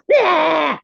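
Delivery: loud, high playful monster bleh; hammed-up monster noise to make kids laugh; mock monster. Mother playing a silly monster for her kids, loud. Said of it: "pretend to be a monster".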